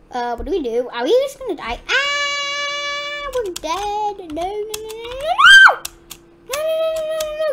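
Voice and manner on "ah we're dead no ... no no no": hammed-up doomed whine; panicked wailing. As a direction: squeal a string of desperate noes. Facing death from monster.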